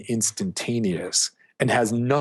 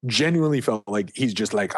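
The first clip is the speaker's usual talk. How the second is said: normal talking voice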